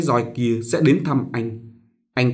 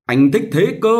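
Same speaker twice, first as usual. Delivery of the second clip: higher, louder stubborn whine; petulant child; 9 semitones higher, 5 dB louder than usual. Acted. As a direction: throw a toddler's tantrum line. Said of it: "anh vùng vằng".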